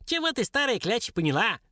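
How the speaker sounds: high, sneering dwarf question; mocking. A narrator's reading. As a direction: taunt the old woman. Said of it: "насмешливо спросил Цараш". (насмешливо)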